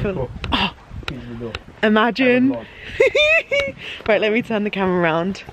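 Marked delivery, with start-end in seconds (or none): 2.6-4.0 delighted laugh at the lodge; high squealing laugh. Real, not acted.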